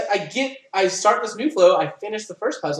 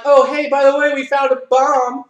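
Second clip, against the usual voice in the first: breezy, higher and louder, like it's nothing; mock casual; 7 semitones higher, 7 dB louder than usual. Teammates telling the player they found a bomb, mock breezy. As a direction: toss out the bomb news offhandedly.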